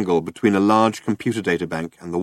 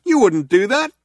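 high, raised protest; crying out; 12 semitones higher, 5 dB louder than usual. A narrator's reading. Trillian protesting to the cops, raised and high. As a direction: protest in disbelief. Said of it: "cried Trillian".

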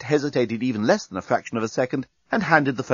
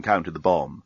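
usual speaking voice

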